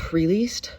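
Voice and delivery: long unvoiced breath out, letting go; deep releasing sigh. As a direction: sigh the tension away slowly. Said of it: "I took a couple deep breaths".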